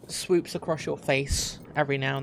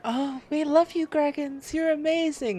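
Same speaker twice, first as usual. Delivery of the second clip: much higher, gushing adoring voice; fawning women; 12 semitones higher than usual. Acted. Tavern women gushing over Graggins, high and adoring. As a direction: swoon over the hero like a tavern admirer.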